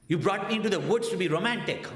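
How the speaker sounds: loud, high, fast sarcastic complaint with a laugh; upset wife. Retold or imitated. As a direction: throw it out in mock outrage. Wife upset in the dark woods.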